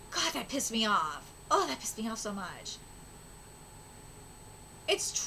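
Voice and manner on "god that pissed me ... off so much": grumbled. Real, not acted. Streamer grumbling at game.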